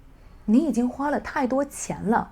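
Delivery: complaining tone in 你已经花了太多钱了; 抱怨的语气. Shown as a demonstration. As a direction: grumble it like scolding a spender. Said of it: "I'm using a complaint intonation".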